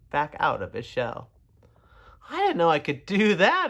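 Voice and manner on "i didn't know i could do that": mildly delighted. A narrator's reading. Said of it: "he laughed".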